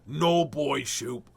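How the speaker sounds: very slow, drawn-out goofy voice; lightly voiced cartoon character; silly character voice. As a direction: drag out each word in a funny voice. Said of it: "Mostly because I like doing this voice".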